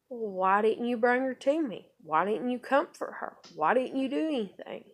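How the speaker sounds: imitating herself confronting him, pressing each question; exasperated demand. Mother confronting him over crying daughter, exasperated.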